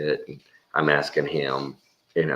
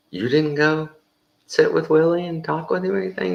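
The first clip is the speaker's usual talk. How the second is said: high, louder incredulous question; can't believe what he's hearing; raised, disbelieving; 13 semitones higher, 5 dB louder than usual. Retold or imitated. Disbelief at buddy's story.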